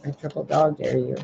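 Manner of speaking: ordinary talk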